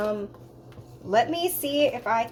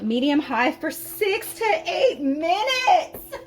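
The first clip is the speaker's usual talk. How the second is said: slow, high, laughing through the words; laughing disbelief; 7 semitones higher, 5 dB louder, slower than usual. Real, not acted. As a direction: reads it out half-laughing, half-despairing. Baffled reading of the recipe.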